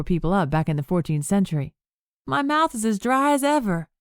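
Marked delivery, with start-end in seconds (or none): 2.3-3.9 high, whiny complaint; whining. Acted. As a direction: whine it like a sulky child. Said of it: "Annabelle whined".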